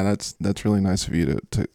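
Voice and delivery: usual speaking voice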